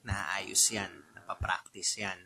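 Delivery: soft unvoiced whisper, reassuring; whispered reassurance. Real, not acted. Whispering to call center hopefuls, reassuring softly. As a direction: breathe it like a secret comfort.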